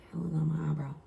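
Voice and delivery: breathy unvoiced whisper of a private worry; daughter's anxious inner voice, whispered; whispered thought.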